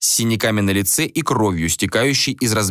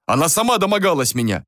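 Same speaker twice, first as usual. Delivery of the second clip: higher-pitched indignant retort; acted raised-voice defence; shouted protest; 7 semitones higher than usual. A narrator's reading. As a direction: throw the accusation back. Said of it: "крикнул Джориан".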